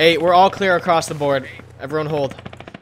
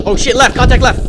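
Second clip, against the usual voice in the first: shouting over gunfire; 9 semitones higher, 8 dB louder than usual. Real, not acted. Sudden enemy contact in firefight.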